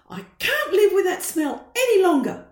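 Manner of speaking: loud, furious mum; angry. Acted. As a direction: storm off yelling it. Mum storms out.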